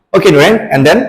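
ordinary delivery

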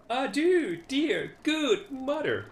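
put-on high, slow pleading child voice; child begging. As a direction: draw out the plea like a child. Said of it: "said the third".

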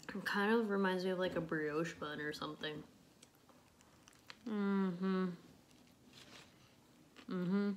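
chewing burger; humming while chewing; mouth full. Real, not acted. Seen 2: Bites burger at 0.1–0.4, then chews with closed, full mouth.

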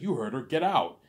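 high, loud order to leave; father ordering his son out, loud and high; stern dad backing mom. Retold or imitated. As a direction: bark it out like a father siding with mom. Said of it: "my daddy's like".

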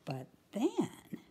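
usual speaking voice

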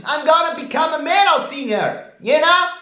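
very high whiny falsetto; high squeaky boast. Shown as a demonstration. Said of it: "start to sound like a hillbilly".

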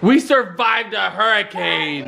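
drawn-out, hammed-up dramatic headline; slow mock-epic proclamation; mock clickbait. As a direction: announce it like an overblown video title. Calling out a mock clickbait title.

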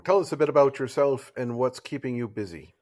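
usual speaking voice

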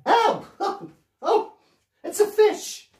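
very high mock-startled yelp; startled squeal. Pirate entertainer finding a fish while cleaning decks, high startled squeal. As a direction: squeal at a sudden comic discovery.

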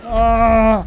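sudden loud high yelp of surprise; startled cry. Real, not acted. Gamer startled by a sudden game event, loud shocked yelp. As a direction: jolt out a loud shocked gasp.

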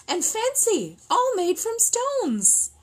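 high, slow, wondering exclaim; marvelling. A narrator's reading. Narrator marvelling to children at soup from stones, slow and high. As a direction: draw out the wonder.

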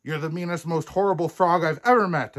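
high, fast, building to loud; snapping princess. A narrator's reading. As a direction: hurl the accusation as a fed-up princess. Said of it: "snapped Princess Poppy".

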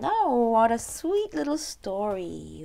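usual speaking voice

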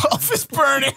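high, hammed-up cry of alarm; mock alarm. Reacting to the plastic smell, mock panic. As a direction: sound the alarm in comic horror.

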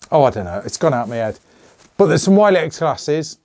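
usual speaking voice

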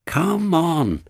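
high, coaxing push; urging. A narrator's reading. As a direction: urge a reluctant engine. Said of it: "he urged".

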